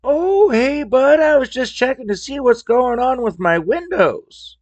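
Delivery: high-pitched, put-on caller voice; exaggerated high mimicry of a customer on the phone; oblivious customer calling in. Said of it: "Guess who calls in at that point?".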